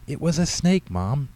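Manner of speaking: put-on high, loud boy insisting; insistent. A narrator's reading. Boy insisting to his doubting mother, insistent. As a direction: plead to be believed, rising on snake. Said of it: "Tyler insisted".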